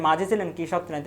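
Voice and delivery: ordinary talk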